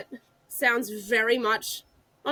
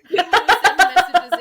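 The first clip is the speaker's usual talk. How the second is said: laughing; 7 semitones higher, 12 dB louder than usual. Real, not acted. Laughing at fans' messages.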